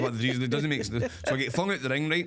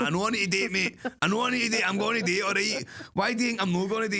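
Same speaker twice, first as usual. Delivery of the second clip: high, exasperated and defensive, a bit fast at the end; rising irritated insistence; exasperated protest; 7 semitones higher, faster than usual. Retold or imitated. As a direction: snap back at a nagging mate. Arguing with mate over gimmick.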